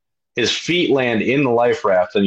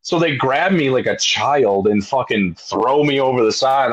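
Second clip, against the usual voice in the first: normal talking voice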